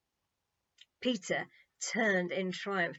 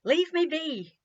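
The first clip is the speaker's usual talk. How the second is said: loud, higher curt dismissal; irritated; 9 semitones higher, 9 dB louder, slower than usual. A narrator's reading. Peter glaring at the crowd, telling them off. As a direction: tell them to back off. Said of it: "swinging round to glare at them all".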